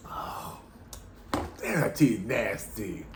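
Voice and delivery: normal talking voice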